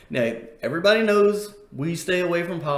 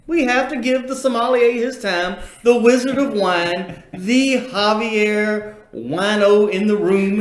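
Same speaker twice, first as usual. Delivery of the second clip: louder, slow and drawn out, hyping the guest; mock announcer intro; 5 semitones higher, 5 dB louder, slower than usual. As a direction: announce him like a ring entrance, slowly. Hyping up the sommelier's entrance.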